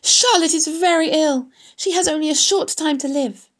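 high, pressing, pleading voice; urgent cry. A narrator's reading. Wilbur begs the rat to fetch the egg sac, urgent cry. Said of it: "cried Wilbur".

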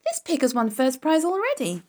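put-on high-pitched boyish alarm; a child's high exclamation of discovery; excited cry. A narrator's reading. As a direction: blurt it out in a high, urgent voice. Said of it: "cried Avery".

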